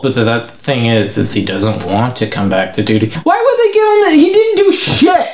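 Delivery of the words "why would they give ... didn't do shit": very high incredulous squawk; indignant. Real, not acted. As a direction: protest in a pitched-up voice. Ranting at the dog's reinstatement.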